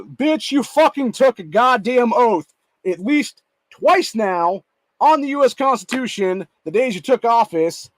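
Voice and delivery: loud, high, slow and emphatic; heated telling-off, drawn out for emphasis; angry rebuke. Real, not acted. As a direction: hammer each word at the politician in outrage. Furious reply to the mocked politician.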